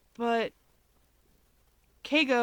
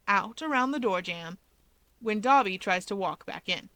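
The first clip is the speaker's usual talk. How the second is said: ordinary delivery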